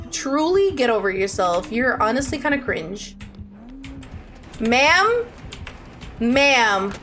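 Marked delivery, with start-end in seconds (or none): none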